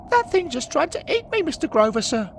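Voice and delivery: put-on very high, loud panicked boy; trembling, upset boy. Boy tells Henry the ghost attacked him, trembling and upset. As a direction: squeal it out as a frightened lad. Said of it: "The boy, trembling and upset".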